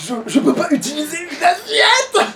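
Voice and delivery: high, slow fake whimper; mock sob. Player mock-crying over a shield he can't lift, fake sob. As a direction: whine it like a wounded child.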